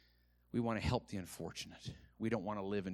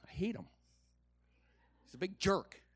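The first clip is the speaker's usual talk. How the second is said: high-pitched pretend resentment; mock envy; 9 semitones higher than usual. Preacher joking about best friend's cruise, mock envy. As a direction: grumble jokingly in a raised pitch.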